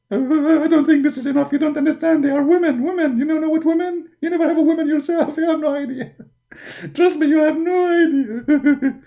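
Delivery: high, pleading, panicky complaining; whiny prophet. Acted. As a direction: whine about scary wives. Muhammad insists about women.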